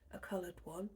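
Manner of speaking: ordinary delivery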